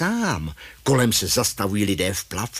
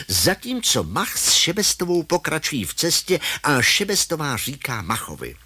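plain reading voice

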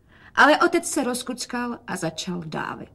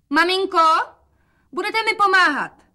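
high-pitched, raised-voice call; 11 semitones higher, 5 dB louder than usual. A narrator's reading. Daughter asks mother for help, calling out.

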